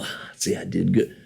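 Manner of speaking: whispered, self-satisfied mock aside; smug aside. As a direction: pat yourself on the back under your breath. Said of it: "they can kinda go".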